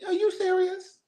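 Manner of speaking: shocked. Real, not acted. Shocked reaction to news.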